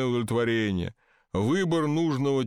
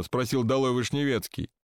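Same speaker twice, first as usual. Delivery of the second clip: usual speaking voice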